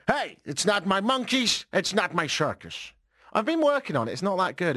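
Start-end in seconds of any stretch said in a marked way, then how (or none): 0.1-2.9 lispy, higher-pitched mock politician; Donald Trump. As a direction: drag it out with a slushy lisp. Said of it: "Trump said".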